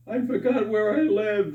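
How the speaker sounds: high-pitched wailed punchline; mock tearful. Old lady explains why she's crying, mock tearful wail. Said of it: "and she's crying".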